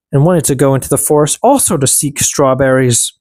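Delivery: ordinary delivery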